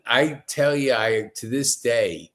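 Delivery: ordinary talk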